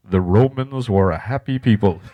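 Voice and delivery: slow, low, mock-pompous narrator; stodgy documentary narrator. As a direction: mimic a dull educational voiceover. Mocking dull educational programming.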